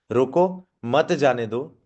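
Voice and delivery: slow, रोको alone, then मत जाने दो together; pause after रोको. Shown as a demonstration. Trainer repeating the first meaning for learners, pause after रोको. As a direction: halt on रोको before saying मत जाने दो. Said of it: "हल्का सा गैप की वजह से".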